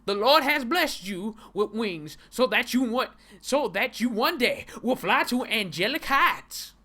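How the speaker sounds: loud, high preacher proclamation; mock preacher. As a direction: belt it out like a preacher at full force.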